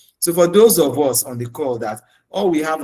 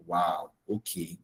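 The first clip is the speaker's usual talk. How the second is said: slow, much softer and lower "wow, okay"; unimpressed reaction; 6 semitones lower, 10 dB quieter, slower than usual. Retold or imitated. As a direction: let it drop low and quiet, unimpressed. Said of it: "I'm looking at them like".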